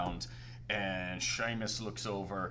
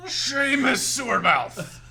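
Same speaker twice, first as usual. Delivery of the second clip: announcer voice; 13 semitones higher, 11 dB louder than usual. Acted. Fat man introduces the contestants, loud ringmaster call. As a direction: boom out the matchup, laughing. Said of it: "in this deep baritone voice".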